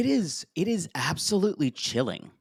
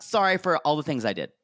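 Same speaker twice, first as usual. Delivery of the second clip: rushed apology; faster than usual. Retold or imitated. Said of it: "I'm like".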